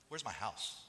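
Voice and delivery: soft, low muttered question; subdued complaint, voice falling away; deflated demand. Acted. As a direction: drop the shout and ask quietly. Same demand, suddenly dropped after shouts.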